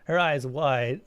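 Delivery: ordinary delivery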